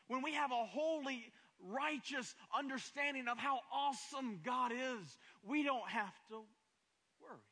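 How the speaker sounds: high, loud, building sermon cadence; preaching at full force. Acted. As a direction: drive each "I don't have to worry" home like a revival.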